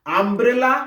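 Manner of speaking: আমব্রেলা set apart to show its opening "a"; U sounded as "a". Shown as a demonstration. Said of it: "ইউ এর উচ্চারণটা আয়ের মতন করছি".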